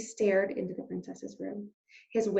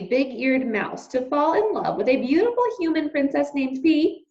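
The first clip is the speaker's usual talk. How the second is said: plain reading voice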